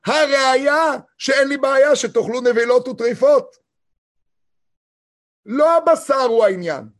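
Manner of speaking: fervent sermon climax; full-force preaching. Acted. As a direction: drive the point home from the pulpit.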